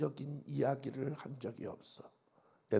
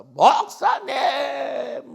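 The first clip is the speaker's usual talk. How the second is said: a drawn-out, higher, louder cry of the pastor's title; wailing call; 9 semitones higher, 14 dB louder, much slower than usual. Retold or imitated. Deaconess crying to the pastor on the phone, long loud wail. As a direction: stretch the call like a sob. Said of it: "막 그러면서 통곡을 하고 울어".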